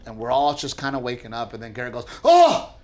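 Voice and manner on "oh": high, loud imitation of a panicking child; alarmed little boy. Son alerts dad in bed, mock alarmed child voice. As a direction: cry out like a kid alerting dad. Son's alarmed cry acted out.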